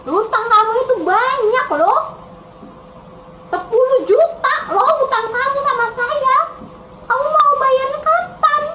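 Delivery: high, insistent creditor king; demanding king. Acted. King demanding the ten million back, high and insistent.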